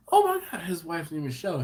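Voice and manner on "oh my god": high-pitched amazed outburst; excited coworker. Retold or imitated. Coworker reacting to homemade cocktail sauce, excited impression. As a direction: imitate a thrilled coworker, pitched way up. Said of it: "He was like".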